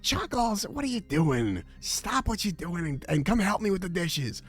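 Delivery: lightly voiced nagging ex-wife; Delilah nagging. Delilah calling Chuckles to do the dishes, mildly high. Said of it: "that's what it sounded like in our car".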